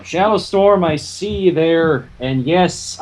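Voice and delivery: usual speaking voice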